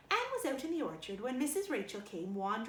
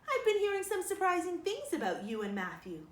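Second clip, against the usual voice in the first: higher, louder gossiping neighbour; Rachel Lynde's voice; 7 semitones higher, 5 dB louder than usual. A narrator's reading. Rachel Lynde to Marilla, nosy and eager. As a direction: lean in with nosy relish.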